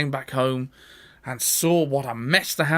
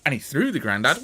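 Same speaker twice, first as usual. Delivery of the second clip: ordinary delivery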